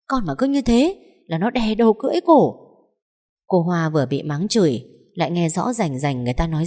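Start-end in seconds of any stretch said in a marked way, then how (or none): 0.1-2.5 a touch of a shrill scold, a bit higher; mother-in-law griping about her daughter-in-law, raised pitch; lightly voiced angry old woman. A narrator's reading. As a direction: grumble darkly like a spiteful mother-in-law. Mother-in-law grumbles at Hoa.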